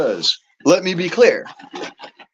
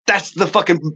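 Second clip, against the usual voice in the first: ordinary talk, nothing marked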